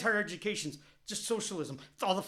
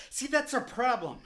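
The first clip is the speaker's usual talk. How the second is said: ordinary talk, nothing marked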